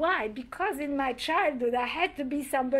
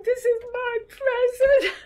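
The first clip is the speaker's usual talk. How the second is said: much higher and louder, laughing while voicing the tears; mock crying child; 11 semitones higher, 7 dB louder than usual. Child defending her chicken gift, laughing mock cry. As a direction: play the hurt little girl, half laughing. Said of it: "And I started crying".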